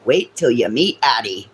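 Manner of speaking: normal talking voice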